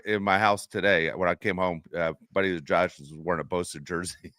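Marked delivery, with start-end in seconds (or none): none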